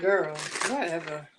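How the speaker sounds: dismissive brush-off. Retold or imitated. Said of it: "I'm like".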